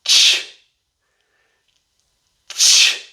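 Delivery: "Ch" as a sharp puff of air, no voice; burst of ch. Shown as a demonstration. Teacher demonstrating the ch burst, puffed. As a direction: pop out "Ch" like a small explosion. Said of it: "a small explosion of air".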